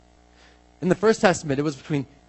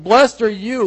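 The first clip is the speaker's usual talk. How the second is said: high, loud, exclaimed blessing; excited raised-voice blessing; bold proclamation; 6 semitones higher, 8 dB louder, slower than usual. Retold or imitated. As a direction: proclaim it to the cousin. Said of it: "It's a bold proclamation".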